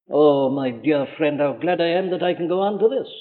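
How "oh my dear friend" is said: normal talking voice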